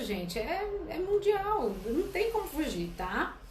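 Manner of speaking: normal talking voice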